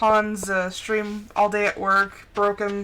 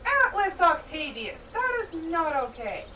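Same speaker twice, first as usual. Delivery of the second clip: higher, slow, stern full-name scolding; scolding pet; 6 semitones higher, 5 dB quieter, slower than usual. Real, not acted. Scolding cat at window.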